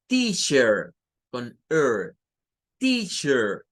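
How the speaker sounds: "Teacher" with an American accent, the r sounded at the end; American accent, curl the final r of "Teacher"; American accent: "Teacher" ending in a clear r. Shown as a demonstration. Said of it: "al estilo de un inglés más norteamericano".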